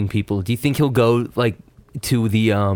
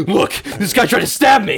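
breathless alarm; 6 semitones higher, 3 dB louder than usual. Retold or imitated. Retold Cooper running to police.

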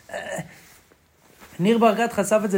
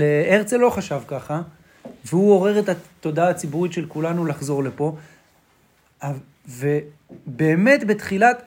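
ordinary talk, nothing marked

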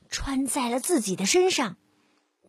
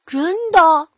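doubtful piglet; 3 semitones higher, 8 dB louder than usual. Acted.

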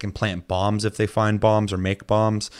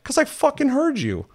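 very high, incredulous retold retort; mock indignant comeback. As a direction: squeal the accusation in comic outrage.

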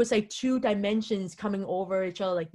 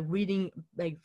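usual speaking voice

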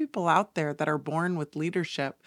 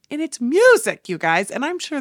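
much higher and louder burst; excited reveal; 10 semitones higher, 11 dB louder than usual. Real, not acted. Telling her viewers about the sound bath, thrilled and loud. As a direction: blurt it out, thrilled.

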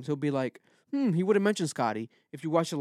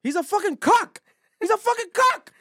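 much higher, louder, half-laughing yell; a real squealing laugh-yell; excited laughing outburst; 4 dB louder than usual. Real, not acted. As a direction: shout it in gleeful disbelief.

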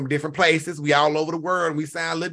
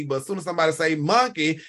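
usual speaking voice

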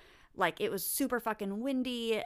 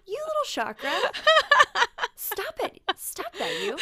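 high, laughing scold of a naughty pet; mock scolding, laughing; 14 semitones higher, 9 dB louder than usual. Scolding her chakra system, high and laughing. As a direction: tease it like a cheeky puppy.

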